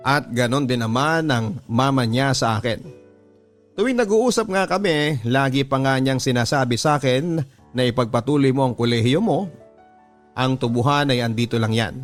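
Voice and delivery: ordinary talk, nothing marked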